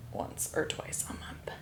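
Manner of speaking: near-whisper, slow and very soft; slow whispered confession; sheepish admission. Real, not acted. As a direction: let it shrink into a whisper. Sheepish admission about rarely going.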